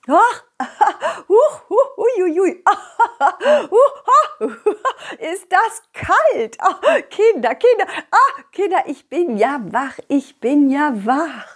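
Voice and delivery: high squealing gasps from cold water; in ice cold water. Acted. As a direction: shriek and giggle at the cold splash. Teacher splashed with cold water.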